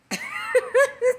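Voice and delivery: bright laugh through tears; mother laughing with relief about her son; high joyful laugh. Real, not acted. Laughing with joy over the cream.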